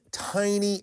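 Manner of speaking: TINY stretched out with emphasis; emphatic stress. Real, not acted. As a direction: draw out the word TINY to stress it. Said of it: "cannot emphasize that word enough".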